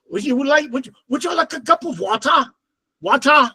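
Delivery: imitated British accent with 'wa-tah'; mock British, crisp T and no final R in water. Imitating cousins offering a drink, British accent. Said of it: "they're speaking British".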